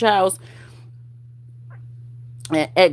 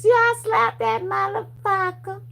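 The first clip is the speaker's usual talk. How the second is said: much higher put-on voice, boasting; telling the police. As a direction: brag it to the cops in a put-on voice. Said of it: "then tell the police".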